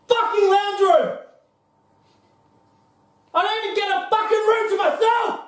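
raging at the roommate over sleeping in the lounge; angry rant. Acted. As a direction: vent it at full frustration. Angry rant about living conditions.

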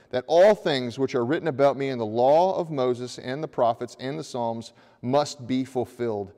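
normal talking voice

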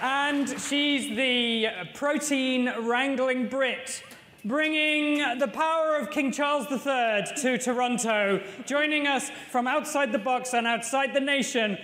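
grand, drawn-out newscast announcer; show announcer. Acted. Host presents the news team to the audience, grand announcer voice. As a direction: introduce the reporters like a big TV opening. Booming show-opening introductions.

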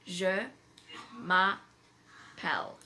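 Je m'appelle, slow and clear, zhuh ma-PELL; slow French demo. Shown as a demonstration. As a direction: say Je m'appelle slowly, each syllable shown.

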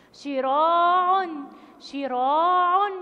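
شراع very slow and loud, the "aa" held; loud, stretched "shiraaa'un" for شراع; long alif madd. Shown as a demonstration. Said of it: "فيها مد بإيه؟".

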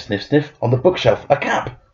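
ordinary delivery, nothing marked

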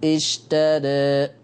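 slow اشترى ending near e; اشترى drawn out, ending in an e-like vowel; taqlil reading. Shown as a demonstration. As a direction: recite اشترى with the final alif tilted toward e. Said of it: "وفي ناس بيقولوا".

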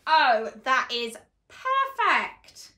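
ordinary talk

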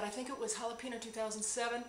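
ordinary delivery

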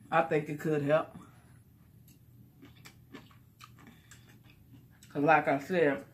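talking while chewing; mouth full, napkin wiping mouth. Real, not acted. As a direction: voice muffled by napkin. Seen 3: Eats noodles before; wipes mouth with napkin 0.3–1.3s.

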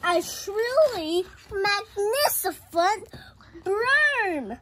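high, loud, drawn-out wonder; grand reveal. A narrator's reading. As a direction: stretch it out as a big unveiling. Climax reveal of the new broom.

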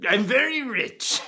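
pompous upper-class toff voice; hammed-up snooty aristocrat; posh rich man. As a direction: lord it over the poor peasants. Posh rich man character throughout.

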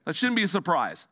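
normal talking voice

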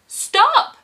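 very high, loud cry to halt. A narrator's reading. Driver to troll at the bridge, high loud cry. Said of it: "cries the driver".

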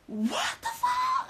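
high cracking shout of horror, slow; panicked shout. Retold or imitated. Seeing a friend hang from the balcony, horrified high shout. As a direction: cry out in disbelief, half laughing.

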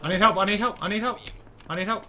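calling for help. Real, not acted. Under attack, calling teammates.

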